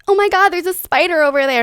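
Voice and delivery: loud, high, rushed mock panic; sudden overblown alarm; dramatic freak-out. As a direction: freak out over a spider, hammed up. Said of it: "dramatically freaking out about it".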